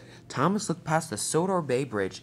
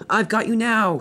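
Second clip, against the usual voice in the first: loud, high taunt; triumphant storybook baddie; gloating villain; 7 semitones higher, 6 dB louder than usual. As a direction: crow at cornered prey. Villain Diesel catches up.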